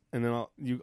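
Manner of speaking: ordinary talk, nothing marked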